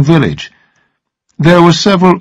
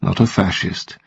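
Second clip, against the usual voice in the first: gently; 6 semitones lower, 9 dB quieter than usual. A narrator's reading. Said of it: "he said gently".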